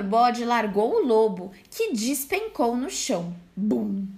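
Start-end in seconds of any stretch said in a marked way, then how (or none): none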